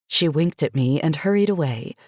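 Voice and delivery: plain reading voice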